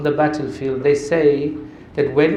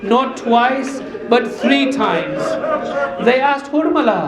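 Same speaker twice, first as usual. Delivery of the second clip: climactic wailing lament; 8 semitones higher than usual. Acted. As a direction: pitch climbing ever higher, words rushing between sobs. Climax of lament, pitch climbing.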